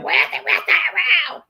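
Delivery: high, drawn-out comic yell; mock outcry. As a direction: call it out high, imitating a startled friend. Retelling friend's turnaround and cry.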